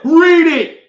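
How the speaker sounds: loud, high mock order; mocking Taraji. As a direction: bark it like a bossy celebrity. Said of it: "She just said".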